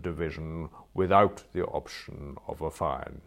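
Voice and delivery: ponderous, emphatic pronouncement; stern magistrate. Acted. Magistrate sentencing a violent prisoner, stern and deliberate. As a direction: deliver judgement weightily, leaning on key phrases.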